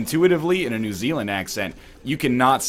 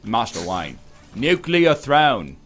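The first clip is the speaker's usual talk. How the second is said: failed Cockney-style actor impression, drawn out; Michael Caine; much slower than usual. Shown as a demonstration. Testing a hard sentence as Michael Caine, botched impression. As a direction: try a famous British actor's voice, slowly, and miss. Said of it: "to say as Michael Caine".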